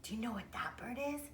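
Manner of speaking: fast hushed whisper. Real, not acted. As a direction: lean in and whisper quickly. Whispered question to child.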